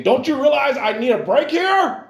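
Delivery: high, loud hammed-up yell; mock exasperated outburst. Preacher voicing a fed-up Jesus at the crowd: mock furious yell. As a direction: blow up at the crowd in pretend exasperation.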